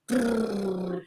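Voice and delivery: soft rolled-lip noise; trilled brrr. Retold or imitated. Crocheter on redoing work over and over, trilled brrr.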